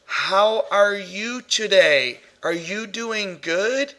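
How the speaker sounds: very slow, over-enunciated questions; patronizing slow talk. Retold or imitated. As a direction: talk down, drawing out every word. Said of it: "Why were you talking like that?".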